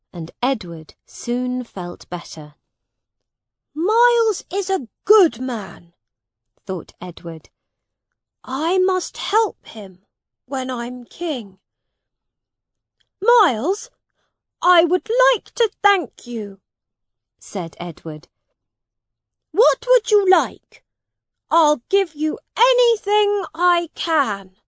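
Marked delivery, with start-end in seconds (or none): 3.7-11.6 put-on high, earnest young prince; boy king Edward. A narrator's reading. Edward thinking about Miles, high and earnest. 13.2-24.6 put-on high, bright young prince offering a reward; a young king graciously thanking his friend; grateful boy king. A narrator's reading. Edward thanks Miles, high and gracious.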